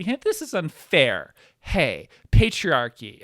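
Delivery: slow, loud whining protest; mock whiny complainer. Comic mocking someone whining to the top, slow and loud. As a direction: drag it out like a sulking complainer. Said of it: "You don't say".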